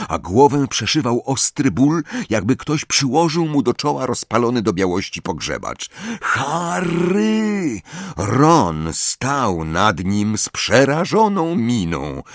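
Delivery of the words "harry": a far-off, raised call of a name, higher; distant urgent call. Acted. Ron calling Harry out of a nightmare, distant and urgent. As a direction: call him as if from far away.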